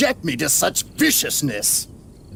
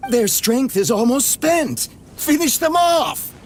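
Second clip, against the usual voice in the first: loud commanding shout to finish them; shouted order; 7 semitones higher, 3 dB louder than usual. Acted. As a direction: bark the kill order high and loud. Commanding his men in battle.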